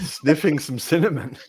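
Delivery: words through a laugh; joking while laughing. Real, not acted. Joking to friends about sniffing cinnamon, laughing.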